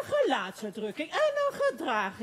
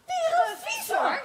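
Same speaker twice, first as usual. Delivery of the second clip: dramatic reveal; 13 semitones higher, 3 dB louder than usual. Acted.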